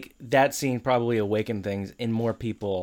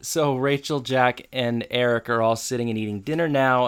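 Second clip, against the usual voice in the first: ordinary delivery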